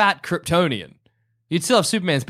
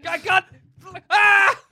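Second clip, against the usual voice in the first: sudden shout; 13 semitones higher, 4 dB louder, slower than usual. Pretend. Shouted exclamation.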